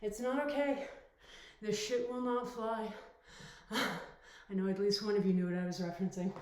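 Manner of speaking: usual speaking voice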